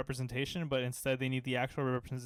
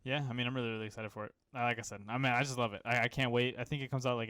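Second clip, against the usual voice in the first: ordinary talk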